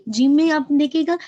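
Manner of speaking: ordinary talk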